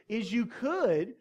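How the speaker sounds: ordinary talk, nothing marked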